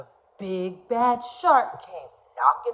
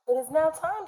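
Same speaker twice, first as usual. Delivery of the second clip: mama fish; 8 semitones higher than usual. Acted. Mama fish speaks to children.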